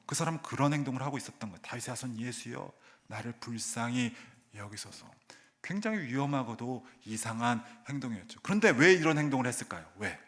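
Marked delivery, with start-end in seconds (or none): 1.6-5.1 hushed plea. Retold or imitated. Quiet retold plea.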